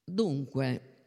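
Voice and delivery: ordinary delivery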